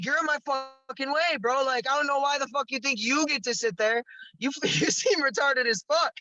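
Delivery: high-pitched angry telling-off, half laughing; yelling at a man blocking the corner; retold yelling. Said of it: "I was fucking yelling at him".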